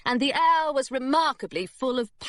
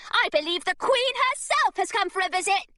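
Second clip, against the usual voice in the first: breathless excitement; 11 semitones higher than usual. Acted. Girl fooling secretary.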